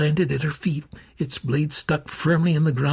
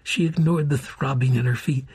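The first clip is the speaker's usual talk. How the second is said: ordinary delivery, nothing marked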